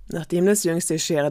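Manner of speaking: plain reading voice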